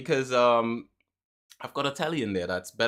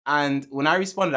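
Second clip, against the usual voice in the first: usual speaking voice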